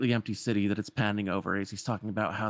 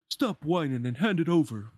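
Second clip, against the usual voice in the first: higher, forceful demand; bullying villain; 6 semitones higher, 3 dB louder than usual. Acted. Pete demanding the lamp from the peddler, bullying. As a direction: order it like a cartoon bully.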